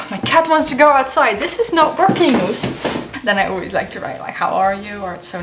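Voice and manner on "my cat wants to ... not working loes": loud, higher exasperated complaint; fed up with the cat. Real, not acted. Cat interrupts filming.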